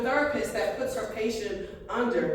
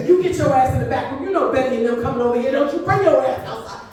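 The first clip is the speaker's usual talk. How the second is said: louder scolding parent; 6 dB louder than usual. Retold or imitated. Mother ordering her special-needs kid to stay hidden from guests, loud scolding. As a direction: bark it like a mother ordering a kid to the back room.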